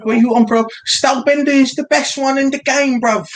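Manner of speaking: hyping Stylebender like a London roadman; London roadman accent. Retold or imitated. Friend mocking a UFC fan in a hammed-up London roadman accent.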